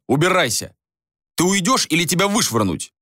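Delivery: acted angry ultimatum; angry dismissal. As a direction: throw her out with rising temper. Acted quarrel, ordering her out.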